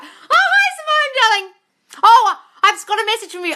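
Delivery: very high, loud delighted greeting; excited greeting. Real, not acted.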